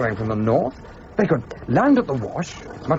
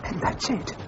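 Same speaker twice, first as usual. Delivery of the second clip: whisper; 6 semitones higher, 8 dB quieter than usual. Acted. Realising the plot while hiding.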